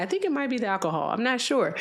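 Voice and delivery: usual speaking voice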